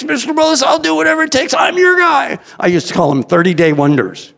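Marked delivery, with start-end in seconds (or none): 0.0-2.4 high, hammed-up eagerness; over-eager applicant. Job applicant promising the boss anything, hammed-up eager. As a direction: pitch it way up, mock-keen to get hired.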